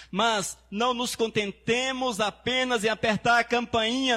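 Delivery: high ringing preacher's cry; preaching at full force. Acted. Pastor calls out to the church, preaching at full force. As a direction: lift the church with the promise.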